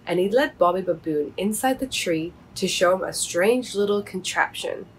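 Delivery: ordinary delivery, nothing marked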